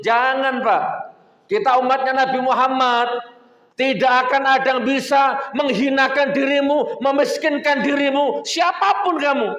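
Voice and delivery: high and loud, preaching with full force; fiery preaching. Acted. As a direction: rouse the congregation. (berceramah dengan semangat berapi-api, tinggi dan lantang)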